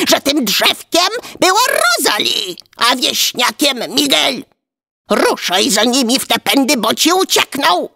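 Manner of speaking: high, scolding shriek; wife screaming. A narrator's reading. Giant's wife berating her clumsy husband, screeching. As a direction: berate him in a high-pitched rant. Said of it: "a ta wrzasnęła".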